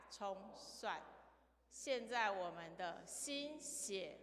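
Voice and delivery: slow, measured read-aloud with key words drawn out; slow scripture reading. Shown as a demonstration. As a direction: lead a group reading, stretching the key words. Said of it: "你就念慢一点". (慢速领读经文，重点词拉长)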